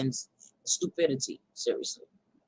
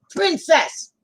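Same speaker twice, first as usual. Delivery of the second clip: high, raised call to a pet; calling the dog; 10 semitones higher, 5 dB louder than usual. Real, not acted. Owner interrupting chat for her dog, calling out. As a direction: call the dog's name out loud.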